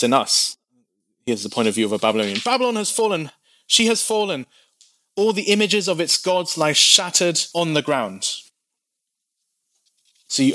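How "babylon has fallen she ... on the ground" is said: higher, urgent cry of defeat; alarmed announcement. Acted. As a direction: call out the fall in alarm. Watchman announces Babylon's fall.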